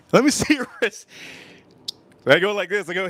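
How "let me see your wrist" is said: put-on high old-man voice breaking into a laugh. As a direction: squeak it out and laugh mid-line. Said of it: "He says".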